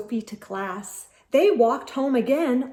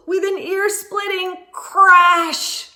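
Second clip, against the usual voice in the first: louder, slow and dramatic; big crash; 7 semitones higher, 7 dB louder than usual. A narrator's reading. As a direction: slam the crash out slowly.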